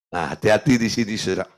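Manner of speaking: usual speaking voice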